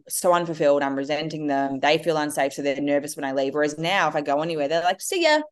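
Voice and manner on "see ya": put-on high, bright little-boy "see ya"; cheerful kid. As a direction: toss it off like a carefree kid. Sons cheerfully waving mum off.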